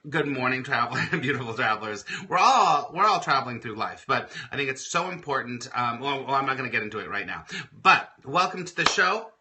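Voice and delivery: ordinary talk, nothing marked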